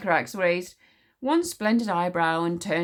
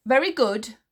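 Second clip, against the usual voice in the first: louder, brisk, pleased queen; satisfied sniff; 8 semitones higher, 5 dB louder than usual. A narrator's reading. Queen pleased order is restored, satisfied. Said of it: "sniffed Queen Sycorax with satisfaction".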